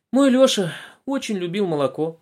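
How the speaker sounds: выше и громче, старушечий голос; сыграй бабушку; голос бабушки. A narrator's reading. Бабушка говорит. (бабушка рассказывает Булочкину, старушечьим голосом)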